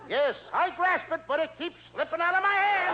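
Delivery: high, rising exasperated wail; exasperated outburst. Acted. Confused pupil telling his explainer it keeps slipping, exasperated.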